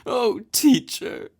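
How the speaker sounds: very high, slow wailing cry; a goddess sobbing to her temple priest. Acted. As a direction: wail to the teacher through tears. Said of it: "sobbed Ozma".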